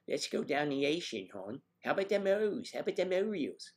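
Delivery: high, loud Baltimore holler; Baltimore accent. Shown as a demonstration. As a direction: ham up a broad Baltimorean accent. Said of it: "heavily laden accent of a Baltimorean".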